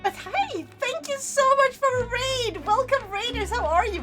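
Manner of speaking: high bubbly greeting; breathy cutesy giggly. Real, not acted. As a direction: greet them cheerfully. Greeting raiders cheerfully.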